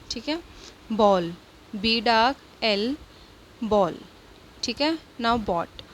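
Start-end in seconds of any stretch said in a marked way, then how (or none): 0.9-1.3 बॉल said with one long aw sound; model word demo. Shown as a demonstration. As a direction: hold the aw in बॉल for the class. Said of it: "doubling नहीं करनी है आपको, गलत है". (बॉल को लंबे ऑ के साथ साफ़ बोलना)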